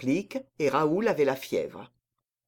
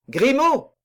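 raised, loud summons; calling out; 9 semitones higher, 8 dB louder than usual. A narrator's reading. Count calling his servant out of the window, loud cry. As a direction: call sharply up in pitch to someone outside. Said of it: "cria-t-il".

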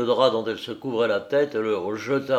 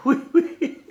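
much higher, choked with giggles; stifled laughter; 4 dB louder than usual. A narrator's reading. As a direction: squeak it out through held-in laughter. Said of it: "elle balbutia en étouffant".

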